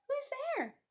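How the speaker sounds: very high, frightened little mouse; tiny mouse calling out; high mouse cry. Acted. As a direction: call out high and small into the dark. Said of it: "Mouse cries".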